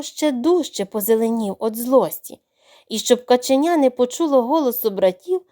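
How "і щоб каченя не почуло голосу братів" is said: ordinary delivery, nothing marked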